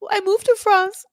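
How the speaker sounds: mock the wife whining at her husband; shrill whiny wife. Mocking the wife yelling at her husband in a high whine. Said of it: "She's like".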